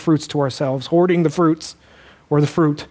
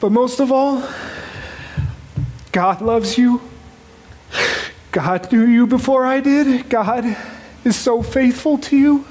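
rising emotion; 5 semitones higher than usual. Real, not acted. Father's tearful blessing, rising.

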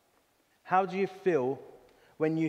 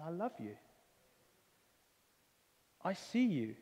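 soft, much quieter, tender; tender quiet voice of God; 10 dB quieter than usual. Retold or imitated. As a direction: speak it gently, like a loving whisper of reassurance.

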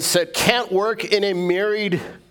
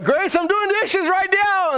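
calling out to wife. Retold or imitated. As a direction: shout it across the house to get credit. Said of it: "So I'm like".

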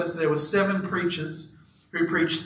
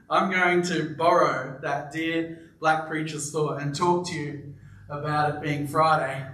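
normal talking voice

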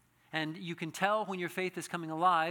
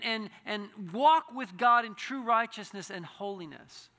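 preaching at full force; 7 semitones higher, 11 dB louder than usual. Acted. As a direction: drive each line home from the pulpit.

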